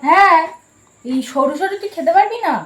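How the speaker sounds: loud teasing. Real, not acted. Teasing sibling.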